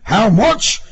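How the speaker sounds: loud, high, indignant shout; outraged cry. A narrator's reading. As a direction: throw it back as an outrage. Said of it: "the officer cried".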